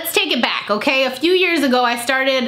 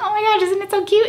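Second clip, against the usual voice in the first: gushing; 9 semitones higher, faster than usual. Retold or imitated. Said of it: "And I'm like".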